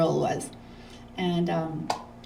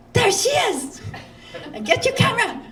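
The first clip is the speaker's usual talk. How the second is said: much higher, thrilled fans shouting, laughing; excited fans. Retold or imitated. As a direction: squeal it like fans spotting a star. Fans spotting her in town.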